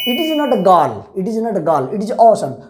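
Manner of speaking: ordinary talk, nothing marked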